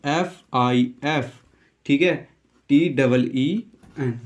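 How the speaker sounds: letters spelt slowly, one by one; slow spelling out. Shown as a demonstration. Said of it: "ये correct इसकी spelling होगी". (हर अक्षर धीरे-धीरे बोलकर स्पेलिंग)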